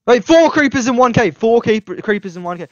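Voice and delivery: loud, high disbelief; shocked. Real, not acted. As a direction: react to four creepers. Shocked at creepers.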